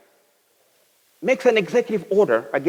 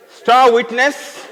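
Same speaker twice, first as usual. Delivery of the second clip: slow punchline; 7 semitones higher, 6 dB louder, much slower than usual. Acted. Punchline list climax.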